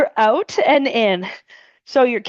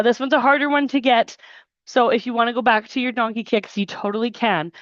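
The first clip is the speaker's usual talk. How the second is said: ordinary talk, nothing marked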